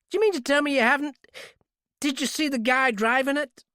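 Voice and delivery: high, fast eager clerk; excited clerk. Acted. Excited clerk presses for details about the driver. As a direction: rush the questions out.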